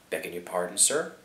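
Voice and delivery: low, soft query rising on "sir"; humble servant asking what was meant; puzzled, deferential question. A narrator's reading. Puzzled servant questions his master.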